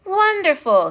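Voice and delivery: high, loud exclamation of delight; excited king. A narrator's reading. King Charles hearing the news, excited. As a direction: burst out with royal excitement. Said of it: "was very excited to hear the news".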